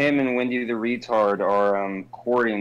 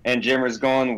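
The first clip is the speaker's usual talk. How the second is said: ordinary talk, nothing marked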